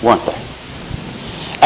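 very slow, breathless whisper; whispered aside. Real, not acted. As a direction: drop to a hush for the question. Rhetorical aside to congregation.

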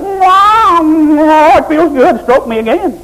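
high, drawn-out purring plea; purring mock contentment; mock pampered member. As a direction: ham up a cat being stroked. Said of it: "you'll go".

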